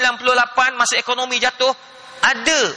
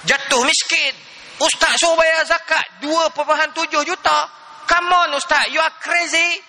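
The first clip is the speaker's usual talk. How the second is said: higher-pitched indignant retort, imitating an outraged man; mock angry husband; 5 semitones higher than usual. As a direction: protest as if insulted, climbing to the end. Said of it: "Oh, dia marah, dia marah".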